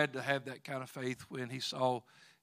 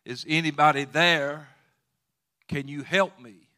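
hanging man calling up; 9 dB louder than usual. Retold or imitated. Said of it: "he looked up into the heaven and he said".

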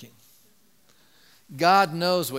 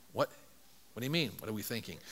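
hushed, lower, feigned innocence; mock innocent mutter; 8 semitones lower, 11 dB quieter, faster than usual. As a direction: play dumb under your breath. Scribes feign innocence to Jesus.

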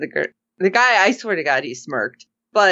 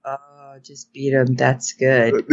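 low, creepy gloating; creepy imitation; 6 semitones lower, slower than usual. Imitating Smoothie enjoying the beating, mock creepy. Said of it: "I just creeped myself out".

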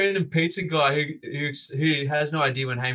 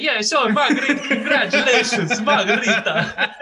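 high, loud, laughing Italian pizza man; laughing through a high Italian announcement; hammed-up Italian accent; 8 semitones higher, 7 dB louder than usual. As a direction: belt it out like an excited Italian pizza guy. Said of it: "he goes".

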